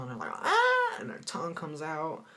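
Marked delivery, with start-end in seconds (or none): 0.3-1.0 high, loud cartoon "ah"; cartoon gawking cry. Old cartoon character sees a hot girl, high cartoon cry. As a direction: mimic a cartoon tongue-drop yelp. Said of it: "they see a real hot girl".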